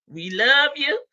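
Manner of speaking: ordinary talk, nothing marked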